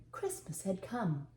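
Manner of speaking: plain reading voice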